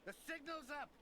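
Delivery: high muffled shout from above; through a wall. Acted. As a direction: call it as if heard through the deck. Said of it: "A muffled voice called up from up above".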